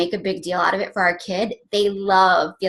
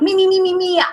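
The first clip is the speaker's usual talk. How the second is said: put-on whiny kids; 11 semitones higher, 4 dB louder than usual. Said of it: "And they're like".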